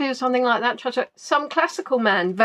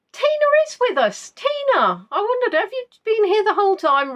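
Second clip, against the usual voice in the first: real high-pitched excited greeting; surprised delight; 11 semitones higher than usual. Real, not acted. Host spotting Tina among the players, surprised delight. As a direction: exclaim it high, as if just spotting a friend.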